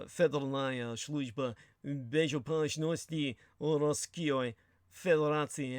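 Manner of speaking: slow, careful Russian-style "Federalnaya Sluzhba Bezopashnosti Rosskyoi Federatsy"; mock Russian rendering of the agency; Russian pronunciation. Shown as a demonstration. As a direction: lay out the full Russian name deliberately. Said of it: "the Federal Security Bureau".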